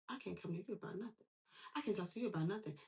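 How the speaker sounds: soft, quick grumble; hurt withdrawal. Retold or imitated. Dismissed friend complaining they can't talk to you, soft withdrawal. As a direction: say it small and fast, giving up.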